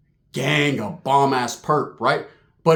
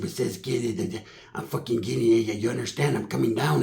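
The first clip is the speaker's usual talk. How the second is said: low, subdued gangster impression; Pepe's homie voice; 7 semitones lower, 7 dB quieter than usual. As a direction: imitate a homie answering low and under pressure. Voicing Pepe struggling with weed.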